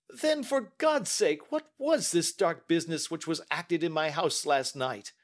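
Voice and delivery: distraught banker demanding an explanation; desperate outcry. A narrator's reading. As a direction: beg for an answer in anguish.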